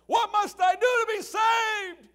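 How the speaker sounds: very high, loud, slow anguished cry; desperate yell. Retold or imitated. Sinner gripping the pew during the sermon, desperate shout. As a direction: cry out in terror for salvation. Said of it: "yell out during the sermon".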